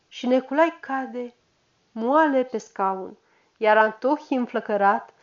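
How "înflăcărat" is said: plain reading voice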